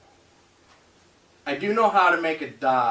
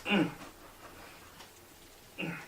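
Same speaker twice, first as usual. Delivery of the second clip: normal talking voice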